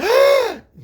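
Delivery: big, loud, high gasp; high dramatic intake of breath; mock scandalized gasp. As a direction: gasp in theatrical shock, imitating a catty friend. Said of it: "He starts talking like that".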